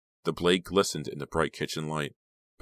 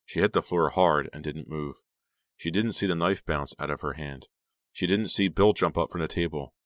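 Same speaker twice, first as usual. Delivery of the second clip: usual speaking voice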